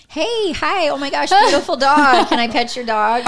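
high, gushing hello to strangers; bubbly greeting. Retold or imitated. Greeting the dog's owners, high and gushing. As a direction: gush over the dog, bright and eager.

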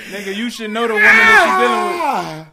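high, loud insisting; shouted point. Real, not acted. To his friends about knowing women, shouting. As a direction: hammer the point home loud.

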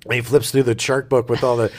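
usual speaking voice

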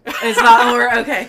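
loud laughing echo of the line; laughing mock repeat. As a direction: throw the words back through a big laugh. Mocks the partner's line, laughing.

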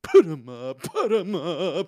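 loud, then high and wobbling slow character voice; hammed-up blustering challenge in a put-on voice; Cowardly Lion. Would-be actor showing off the role, blustering Cowardly Lion. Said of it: "love to play the Cowardly Lion".

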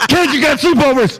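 high, laughing parental call; calling the kids. Pretend. Parent clearing the house for a threesome, mock calling out.

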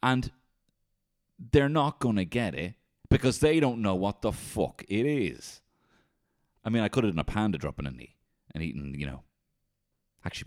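ordinary talk, nothing marked

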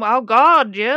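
loud imitation of a Grey Gardens accent; mimicked Grey Gardens accent. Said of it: "his accent sounds like he's sort of".

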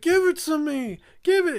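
put-on high, loud childish whine; whiny five-year-old. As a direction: demand it like a kid wanting his toy. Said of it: "It's like a five-year-old, like, wanting his toy".

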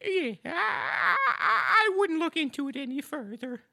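very high, timid squeak; squeaky high voice. Acted. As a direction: pipe up like a nervous little helper.

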